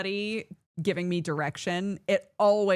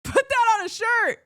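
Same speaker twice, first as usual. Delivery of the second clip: high, loud and laughing; excited shout; 6 dB louder than usual. Real, not acted. Streamer shouting her merch idea with laughing excitement. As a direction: blurt it out like a great idea.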